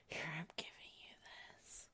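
very soft, hurried whisper; hushed, rapid whispered aside. Real, not acted. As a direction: whisper it quickly, no voice. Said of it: "We whispering".

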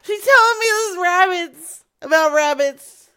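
loud, high mock holler; yelling back. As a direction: shout back to someone in the house. Acted household quarrel during prank call.

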